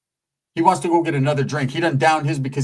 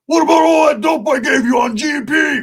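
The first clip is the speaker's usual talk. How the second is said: higher, louder hammed-up shout; mock yell; 8 semitones higher, 5 dB louder than usual. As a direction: bark it like a riled-up biker. Roach yelling at stranger.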